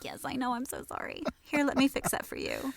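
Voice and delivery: apologetic. Retold or imitated. Voice artist replaying an apology to a client, apologetic. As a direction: plead a little, acting out an apology.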